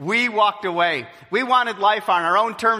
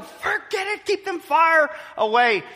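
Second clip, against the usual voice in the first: mock alarmed refusal; 10 semitones higher than usual.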